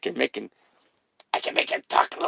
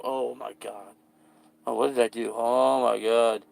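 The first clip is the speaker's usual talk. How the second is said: ordinary talk, nothing marked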